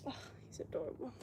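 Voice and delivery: breathy hushed whisper over the dog; soft whisper. Real, not acted. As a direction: whisper it quickly, just for yourself.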